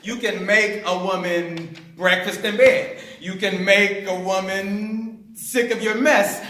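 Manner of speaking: ordinary talk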